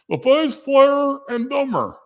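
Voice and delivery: high and slow, sung out; mock sing-song correction. Shown as a demonstration. Streamer corrects his own word, high and slow. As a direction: lift "drummer" way up, drawn out. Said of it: "Drummer, not drummer".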